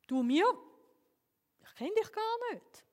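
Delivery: high, startled "you, me?"; the man in heaven, baffled by a stranger's thanks: surprised; astonished disbelief. Acted. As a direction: pitch jumps up in amazement.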